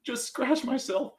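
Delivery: high, laughing put-on brightness hiding pain; fake cheerful. To the worried family, a high fake-cheery I'm okay. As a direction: pretend it's nothing while hurting. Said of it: "I'm like".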